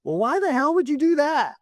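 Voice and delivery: hammed-up grandfather who can't believe it; exasperated grandfather. Grandfather's punchline in story.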